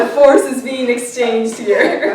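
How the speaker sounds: loud, laughing, hammed-up; mock mystical excitement. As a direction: ham up sensing the vibes. Joking about mind-reading vibes.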